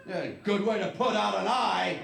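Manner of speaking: mock box slogan. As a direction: read it like cheery packaging copy. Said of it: "It said that right on the box".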